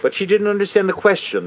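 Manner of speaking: usual speaking voice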